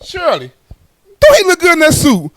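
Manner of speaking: high, loud appeal to a friend; mom seeking backup. Retold or imitated. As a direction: pull in a witness, pitched high. Mom calling a witness.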